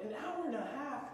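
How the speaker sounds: high-pitched disbelieving question; incredulous echo. Retold or imitated. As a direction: repeat it as if you can't believe it. He echoes the wait in disbelief.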